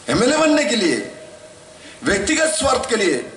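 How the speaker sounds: high-pitched fiery orator, voice pushed up; impassioned rally speech. Real, not acted. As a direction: rail against the years of violence. (ऊँची आवाज़ में जोशीला भाषण)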